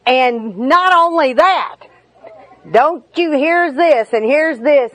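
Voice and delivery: rising, escalating list of grievances; mock heated nagging; piling on complaints. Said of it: "to say".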